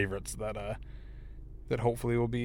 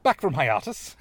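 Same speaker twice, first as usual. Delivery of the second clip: infomercial announcer; 2 semitones higher than usual. Pretend. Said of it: "That sounds like it could be a, like, a medicine infomercial".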